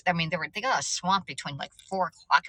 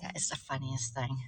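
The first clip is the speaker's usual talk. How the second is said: usual speaking voice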